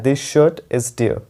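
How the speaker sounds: slow, clearly spaced words; slow, careful teacher read; slow dictation. Shown as a demonstration. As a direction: read it out slowly for students to copy. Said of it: "या".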